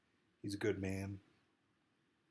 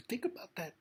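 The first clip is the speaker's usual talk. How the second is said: much higher, pleading repeat; high insistent repeat; 11 semitones higher than usual. Real, not acted. Speaking to Trump, urging him to reflect, high and urgent. Repeats line, pitch jumps.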